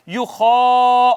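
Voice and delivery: slow, stretched یخا with the alif held one beat; drawn-out Quranic recitation. Shown as a demonstration. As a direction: chant یخا slowly, holding the long vowel. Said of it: "الف کے برابر اس کو کھینچنا ہے".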